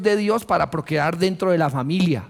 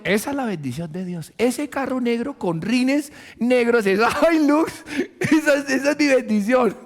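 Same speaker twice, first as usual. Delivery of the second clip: higher, delighted, laughing through the car's name; gushing, laughing buyer; 6 semitones higher than usual. Retold or imitated. A buyer tells himself the truck is God's blessing, laughing. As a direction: gush over the truck as a blessing. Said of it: "en su vientre diciendo".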